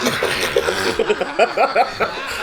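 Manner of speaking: helpless burst of laughter; amused, sustained chuckling; real laughter. Real, not acted. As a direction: crack up at the punchline. Reacting to the punchline.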